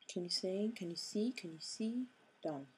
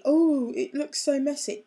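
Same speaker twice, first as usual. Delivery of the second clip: higher, louder mock cry of dismay; 7 semitones higher, 12 dB louder than usual. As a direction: imitate a customer fretting over mess. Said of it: "you're gonna be".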